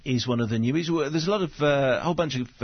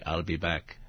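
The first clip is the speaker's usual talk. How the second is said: Arnie impression; 8 semitones lower, 6 dB quieter than usual. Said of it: "'cause he can say".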